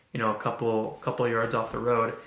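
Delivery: ordinary delivery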